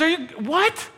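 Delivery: high, rising baffled question; stunned, cut yourself off; father baffled by Jesus, high disbelief; baffled bystander. Retold or imitated. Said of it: "He says".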